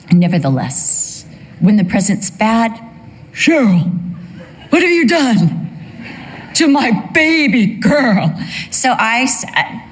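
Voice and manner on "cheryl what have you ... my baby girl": a hint of a husband's playful accusation, pitched higher; lightly voiced husband, mock aghast; mock reproach. Husband teasing his wife over the bad present, lightly mock scolding.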